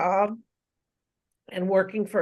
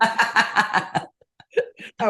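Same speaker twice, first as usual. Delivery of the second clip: laughing; 3 semitones higher, 4 dB louder, much slower than usual. Real, not acted. Laughing about allergy season.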